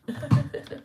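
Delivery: imitated high giggle; high mocking laugh. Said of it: "friend laughing at him".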